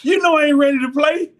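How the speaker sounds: high, loud, fast whiny protest; a panicked player begging; teammate's plea. Retold or imitated. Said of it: "Labenjo comes up to me and said".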